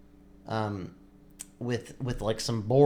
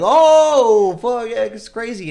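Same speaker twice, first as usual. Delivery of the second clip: huge, high, drawn-out whoop; imitated crowd roar, very loud and high; wild cheering; 11 semitones higher, 13 dB louder, slower than usual. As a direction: explode like amazed buddies. Buddies react to board snapping.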